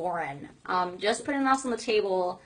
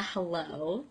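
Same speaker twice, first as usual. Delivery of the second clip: ordinary delivery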